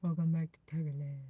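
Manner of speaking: put-on deep host voice; a deepened show welcome. Shown as a demonstration. Streamer welcomes viewers back on request, deep voice. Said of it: "in your deep voice".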